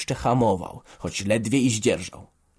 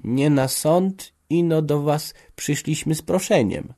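higher-pitched, hesitant woman's voice; slow, tentative start, lifted pitch; timid; 6 semitones higher, slower than usual. A narrator's reading. Blacksmith's wife comes to father with a request, timidly. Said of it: "rzekła nieśmiało Kowalowa".

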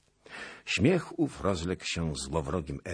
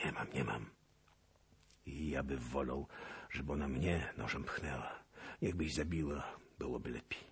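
despairing murmur; 2 semitones lower, 8 dB quieter, faster than usual. A narrator's reading. Cossack sinking in despair.

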